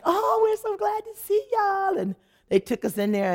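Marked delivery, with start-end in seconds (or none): 0.0-2.0 much higher, gushing welcome voice; cheery hosts. Retold or imitated. The hosts welcoming the family, much higher and gushing. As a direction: gush it like an overeager host. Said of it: "they was like".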